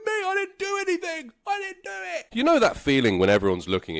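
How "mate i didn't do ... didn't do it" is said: high whiny denial; high protest. Retold or imitated. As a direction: deny it, pitched way up. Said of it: "And I was like".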